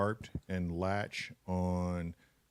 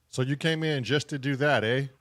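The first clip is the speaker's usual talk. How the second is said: put-on high, lilting Canadian accent with a strong eh; mock Canadian twang rising up at the end; 6 semitones higher, 4 dB louder than usual. Teasing a newcomer in a playful Canadian accent. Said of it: "That sounded very Canadian".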